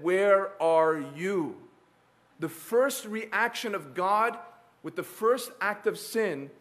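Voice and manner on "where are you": very slow call. Acted. God's question slowed.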